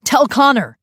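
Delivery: loud, high call after them; yelled. Acted. Father yelling after the guards. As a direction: yell down the hall. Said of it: "Roman yelled after them".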